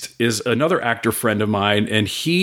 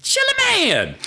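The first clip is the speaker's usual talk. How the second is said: high drawn-out holler; calling out in a high mimicked voice; imitated call-out; slower than usual. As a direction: holler it out in a high, falling wail. Quoting the woman calling out to him.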